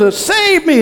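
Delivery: high, loud cry for help; desperate plea. Retold or imitated. Sinking Peter cries to Jesus for help, high and panicked. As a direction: beg to be saved, voice jumping up.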